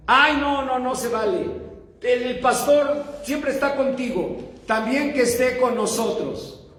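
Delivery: lightly acted indignant kids; imitated children's protest. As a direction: complain like kids demanding their fair share. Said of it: "los demás niños empezaron a protestar".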